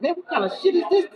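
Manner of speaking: high, fast, squeaky disbelief; mock-shocked yelp at what the drug does. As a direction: play it like you can't believe your eyes. Seeing people on new drugs.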